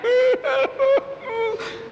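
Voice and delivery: hammed-up wail, pitched very high; a comic high cry of grief; mock wailing. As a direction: howl like a grieving widow. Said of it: "starts to cry like a woman".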